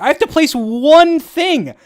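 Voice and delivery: high, slow, loud insistence; emphatic insistence. Real, not acted. Arguing with viewers who doubt him, insistent and slow. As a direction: drive home the point, slowly and firmly.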